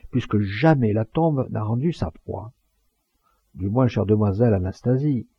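declaimed graveside eulogy with marked pauses; funeral oration. Acted. Mourner addresses the crowd at the grave, solemn oratory.

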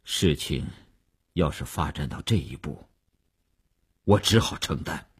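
low, subdued, stunned murmur; halting and quiet, with long pauses; dazed. A narrator's reading. Boss numbly accepting he must take the blame, dazed. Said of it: "吴长天愣愣地说".

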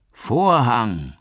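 high, loud falling call of "Vorhang"; theatrical call. A narrator's reading. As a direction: announce the final curtain like an actor. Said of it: "rief Gabriel".

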